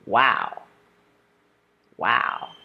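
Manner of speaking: low "Wow" that falls away; falling exclamation. Shown as a demonstration. Teacher modeling exclamations for practice, low falling "Wow". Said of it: "exclamations use a falling tone".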